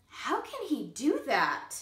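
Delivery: ordinary delivery, nothing marked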